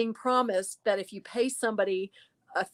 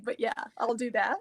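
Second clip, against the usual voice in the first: usual speaking voice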